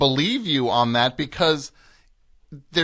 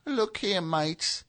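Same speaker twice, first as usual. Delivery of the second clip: mini Peter Grant; 4 semitones higher, 7 dB quieter, slower than usual. Shown as a demonstration. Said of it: "mini Peter Grant".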